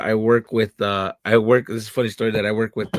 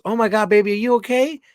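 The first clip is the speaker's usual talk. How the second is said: put-on higher, fussing over a hurt kid; concerned actress; 8 semitones higher, 4 dB louder than usual. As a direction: coo at him like he's hurt. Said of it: "And she goes".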